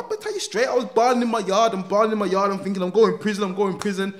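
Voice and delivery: can barely get it out for laughing; laughing hard. Real, not acted. Telling the listener about bawling over his last meal, laughing.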